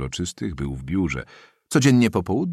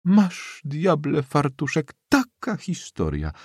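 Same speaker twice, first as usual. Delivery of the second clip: put-on high-pitched old woman's astonishment; old lady amazed at the news; exclaiming; 9 semitones higher, slower than usual. A narrator's reading. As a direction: exclaim in a high voice, easing down at the end. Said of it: "zawołała stara".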